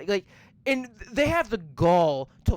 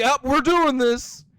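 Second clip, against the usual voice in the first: mock casual; 6 semitones higher, 9 dB louder than usual. Mocking the filmmakers' decision.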